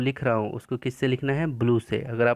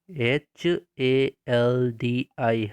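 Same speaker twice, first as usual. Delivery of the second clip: slow, spaced-out letters for copying; slow dictation spelling; much slower than usual. Shown as a demonstration. Teacher dictating spelling slowly to pupils. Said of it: "लिखेंगे आप".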